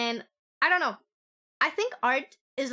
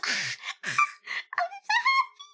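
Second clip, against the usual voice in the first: high, slow, near-tearful squeal of joy; overjoyed squeal; much slower than usual. Real, not acted. Fan overwhelmed by a cute character, tearful squeal.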